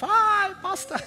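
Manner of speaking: high, gushing "Hi, pastor"; a fan throwing herself at the pastor; eager greeting. Retold or imitated. Churchgoer fawning over the pastor, high and gushing.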